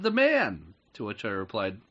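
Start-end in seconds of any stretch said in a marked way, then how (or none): 0.0-0.7 put-on high, light child voice; little girl voice. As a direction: play the small daughter, pitched up. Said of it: "You're doing voices now".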